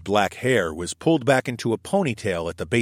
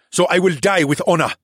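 higher, louder proud vow; defiant declaration; 9 semitones higher, 6 dB louder than usual. A narrator's reading. The cheetah vows to his captors, high and loud. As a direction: proclaim it with stubborn pride.

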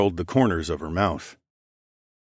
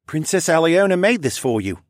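lightly voiced son, pitched up; higher voice, reassuring son; soothing son; 8 semitones higher, 4 dB louder than usual. A narrator's reading. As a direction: explain it to an ailing mother. Son soothes and explains to mother.

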